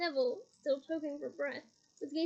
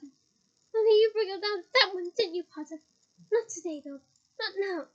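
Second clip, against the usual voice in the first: louder, higher menace; menacing; 4 semitones higher, 8 dB louder than usual. Acted. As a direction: press the threat home. Umbridge menacing Harry.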